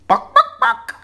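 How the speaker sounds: very high loud clucking; chicken cluck. Acted. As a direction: cluck like a hen. Chickens speak at library desk.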